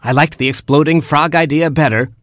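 high, sneering witchy farewell; wicked hornet. A narrator's reading. Hornet sneers goodbye to the party guests, high and spiteful. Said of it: "she hissed".

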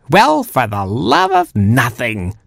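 slow, drawn-out exasperation; exasperated snap. A narrator's reading. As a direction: stretch it out, fed up with the gerbil. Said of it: "snapped Granny Porky".

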